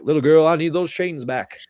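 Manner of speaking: a hint of Music Man's [name] croon; Music Man impression. Scolding the little girl in a mild Music Man [name] voice. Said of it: "And I do a Music Man impression".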